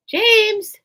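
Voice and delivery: loud, high call of a name. Real, not acted. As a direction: call out to get his attention. Calling out to James.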